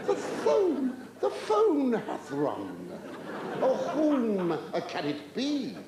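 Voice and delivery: high, drawn-out hammed-up declamation; mock-theatrical wonder. Comic wondering who is phoning, mock-theatrical. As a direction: declaim it slowly like grand old tragedy. Mock-grand thoughts as phone rings.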